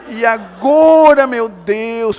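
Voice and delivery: loud, high, slow wail; hammed-up drawn-out anguish; retold desperation. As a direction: cry out to God in mock despair. Retold despair underwater.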